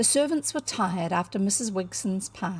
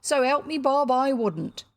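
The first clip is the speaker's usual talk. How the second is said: Cockney burglar; 5 semitones higher, 4 dB louder than usual. A narrator's reading. Burglar swearing earnestly.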